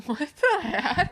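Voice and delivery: drawn-out mock-amazed exclamation; mock astonishment. Podcaster showing cohost a fun-fact reaction, mock astonished. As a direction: stretch it out, voice swooping upward at the end. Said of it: "things that are like".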